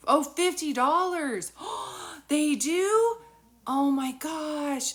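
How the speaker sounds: astonished, high and loud with a gasp; gasping in happy disbelief; delighted surprise. Real, not acted. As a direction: react to amazing news, drawn out. Learns of cheap kids tickets.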